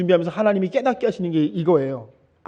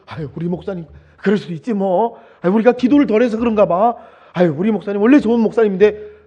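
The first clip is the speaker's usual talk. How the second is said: mock forgiving sigh; 6 semitones higher, 5 dB louder than usual. Congregants forgiving the pastor.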